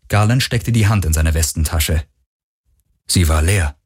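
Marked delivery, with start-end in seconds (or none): none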